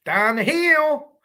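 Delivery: high, very slow drawn-out "Down the hill"; Ron Logan impression. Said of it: "Ron Logan".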